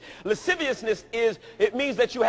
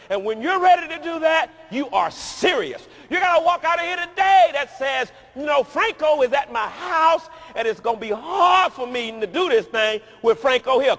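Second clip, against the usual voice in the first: loud, higher shouting sermon; full-force preaching; 5 semitones higher, 8 dB louder than usual. Acted. Preacher rousing the congregation, shouting sermon. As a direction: shout the call to freedom.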